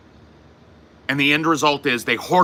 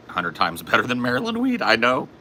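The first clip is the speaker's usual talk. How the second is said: ordinary delivery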